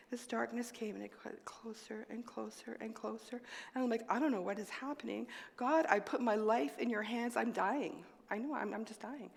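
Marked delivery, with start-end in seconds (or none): 0.1-3.4 softer until whispered; fading whisper. Real, not acted. Darkness closing in. 4.1-9.3 hushed prayer. Retold or imitated. Prayer while fainting.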